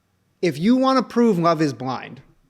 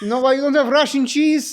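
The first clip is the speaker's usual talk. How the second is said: fake Russian accent; 9 semitones higher, 3 dB louder than usual. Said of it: "That is not".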